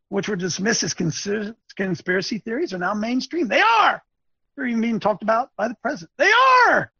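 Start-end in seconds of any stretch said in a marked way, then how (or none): 3.5-4.0 high, loud burst of agreement; emphatic interjection. Real, not acted. Webcaster agreeing with the article he reads, emphatic shout. 6.2-6.9 very high, loud cry of agreement; exultant shout. Real, not acted. Webcaster cheering the article he reads, exultant shout.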